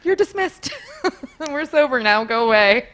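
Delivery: high, imperious send-off through laughter; playful retold brush-off; mock dismissal. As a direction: shoo them away, half laughing.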